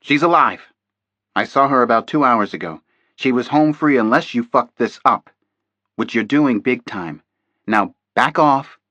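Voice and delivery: loud, heated telling-off; angry snap. A narrator's reading. Contact man berates agent Thompson, loud angry rebuke. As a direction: blame him and order him back.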